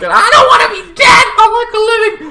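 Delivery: fast, very high panicked shriek; terrified scream. Acted. Sibling afraid of being killed, hammed-up terrified scream.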